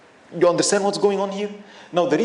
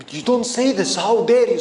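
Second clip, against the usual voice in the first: louder, higher outraged crowd voice; indignant rebuke; 4 semitones higher, 5 dB louder than usual. Acted. Bystanders scolding the man who slandered the imam, loud and outraged. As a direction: scold him for his insolence.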